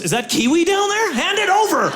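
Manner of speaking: high shouted demand; hammed-up officer pouncing on contraband; alarmed border guard. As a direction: order it surrendered in mock alarm. Acted guard demanding the kiwi.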